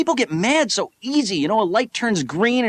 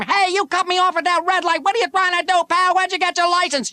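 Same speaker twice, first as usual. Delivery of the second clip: road rage; 10 semitones higher than usual. Retold or imitated. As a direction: yell like a furious driver. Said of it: "starts giving you a hard time".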